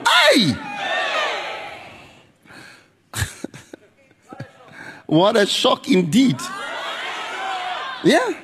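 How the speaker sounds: high, shouted revival preaching; preaching at full force. Acted. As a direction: pound each question home at the congregation.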